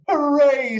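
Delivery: high, loud cheer of joy; excited cheer. Acted. Girl running to her first day, cheering high and loud. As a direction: shout it like a girl racing to school. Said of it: "said Chrysanthemum".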